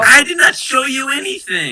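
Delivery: indignant denial. Acted. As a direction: deny it hotly. Hobbit angrily denying.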